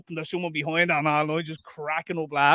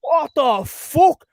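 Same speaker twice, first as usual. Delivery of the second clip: loud, high, slow furious yell; snapped; 10 semitones higher, 8 dB louder, much slower than usual. Retold or imitated. Snapping at the old man taking the parent spot, loud yell. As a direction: let it rip, stretched and high. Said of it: "I snapped, I did".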